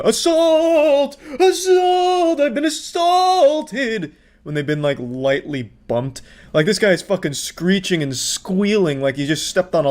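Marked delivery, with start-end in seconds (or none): 0.0-4.1 very high, drawn-out mock shriek; mock scream. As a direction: screech it like a melodramatic victim. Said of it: "people that just scream".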